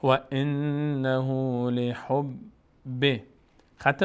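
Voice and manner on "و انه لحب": slow, drawn-out chanted Arabic recitation; slow Quran recitation. Shown as a demonstration. Teacher slowly reciting the verse for the student, chanted. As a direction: stretch every syllable as in tajweed. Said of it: "teacher recites verse".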